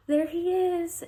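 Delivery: excited announcement. Retold or imitated. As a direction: announce the newborn with delight.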